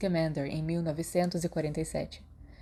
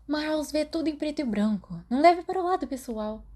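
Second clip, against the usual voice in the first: put-on much higher, gentle girl's voice; Lily consoling; 10 semitones higher, 4 dB louder than usual.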